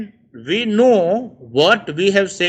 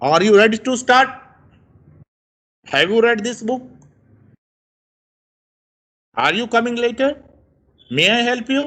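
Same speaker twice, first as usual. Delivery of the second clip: higher voice, climbing to the end as on "start?"; rising yes-no question; 4 semitones higher, faster than usual. Shown as a demonstration. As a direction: lift the pitch on the last words, like "help you?" and "money?". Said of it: "tone rises".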